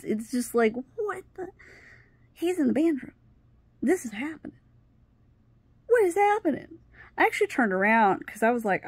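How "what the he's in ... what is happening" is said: high-pitched, halting disbelief; retold disbelief. Thinking to herself about the boyfriend inside, stunned disbelief. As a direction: replay stunned thoughts in a high voice.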